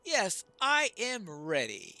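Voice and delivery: loud, slow, stately answer; resolute dwarf leader. Acted. Dwarf leader answering a friend before the moot, loud and resolute. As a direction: declare it firmly and ceremonially.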